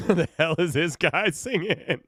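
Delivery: laughing, slowed, half-incredulous; baffled laughing. Retold or imitated. First hearing the band, laughing in disbelief. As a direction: laugh through the question in disbelief. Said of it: "That's me when I first listened".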